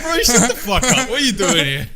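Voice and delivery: laughing through a high, loud mock telling-off; half-laughing fake outrage at a brand; mock exasperated rant. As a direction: scold the air conditioner ad while cracking up. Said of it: "And I was like".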